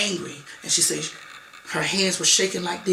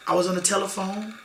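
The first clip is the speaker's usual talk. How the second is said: ordinary talk, nothing marked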